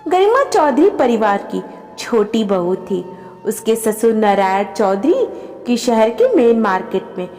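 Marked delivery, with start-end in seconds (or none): none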